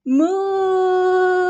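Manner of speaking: high, long mooing call; cow moo. Acted. Tom imitates a cow.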